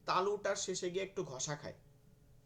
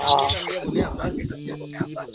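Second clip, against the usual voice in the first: coaching call; 13 dB louder than usual. Real, not acted. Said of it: "teacher coaching the class".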